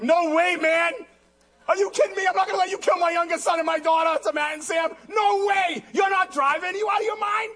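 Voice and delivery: high, incredulous protest; indignant refusal. Retold or imitated. Dad turning down his sons' offer to drive, indignant refusal. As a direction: raise the pitch in mock-outraged refusal.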